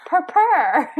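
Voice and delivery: put-on "Purr, purr" high and loud as a toddler's "potions"; toddler saying potions. Mom imitating her son insisting on "purr, purr", high and loud. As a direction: belt out the kid's "purr, purr". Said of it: "And he's like".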